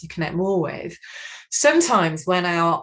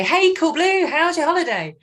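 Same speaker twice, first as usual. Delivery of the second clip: much higher and louder, chirpy hello; bubbly greeting; 11 semitones higher, 7 dB louder than usual. Retold or imitated. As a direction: bounce up to a friend all bubbly. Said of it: "they bebop up to their desk and say".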